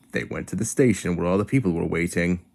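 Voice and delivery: plain reading voice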